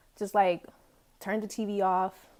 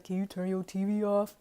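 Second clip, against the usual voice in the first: imitated roommate voice. Roommate in bed asking about the TV, mimicked voice. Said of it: "that's kinda how it sounded".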